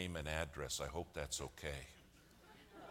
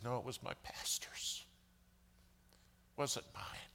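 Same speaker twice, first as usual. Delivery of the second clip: whispered, passing the blame; sheepish whisper; 6 semitones higher, 3 dB quieter than usual. Retold or imitated. Telling the governor it was the pastor's idea, sheepish whisper. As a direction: hush it like a guilty aside.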